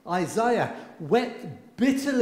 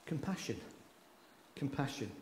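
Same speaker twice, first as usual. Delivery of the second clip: soft repeat; 4 semitones lower, 14 dB quieter than usual. Real, not acted. Preacher lets the word sink in.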